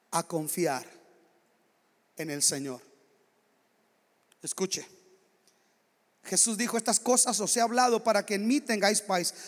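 gentle pastoral whisper-close preaching; intimate closing appeal. Acted.

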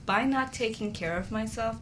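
normal talking voice

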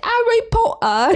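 hammed-up British name; overdone British accent; mock British accent. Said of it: "doing all the movie characters".

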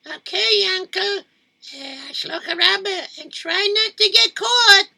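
put-on high little kid's voice; high child voice. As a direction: pipe it up like a child. Child answers uncle.

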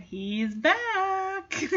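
high, drawn-out mock announcement; teasing announcement. Sassy remarks on excited Chance, high playful teasing.

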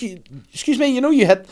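high, rising to a loud yelp; high indignant call. Retold or imitated. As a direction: call after her, outraged and high. Calling after the ignoring woman.

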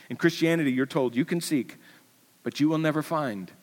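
normal talking voice

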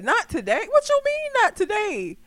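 high-pitched mock indignant questioning; incredulous comeback. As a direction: throw the words back in pretend disbelief. Acts out her own indignant comeback.

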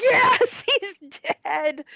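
high, loud gleeful cheer breaking into a laugh; retold cheer from the cinema seat. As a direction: cheer like your enemy just fell. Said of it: "We're like".

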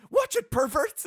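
high, loud mock outburst; imitated yell. Quoting Alan's line at the tailor, yelling. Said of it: "just yells at him".

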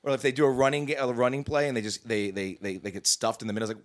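ordinary talk, nothing marked

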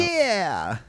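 high, drawn-out mock yeah; pop-punk kid. Co-host mocking the pop-punk vibe, high mock punk voice.

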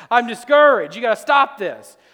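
high, loud complaining Elijah; whiny Elijah. Acted. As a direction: whine it at God like a desperate prophet. Elijah voiced complaining to God.

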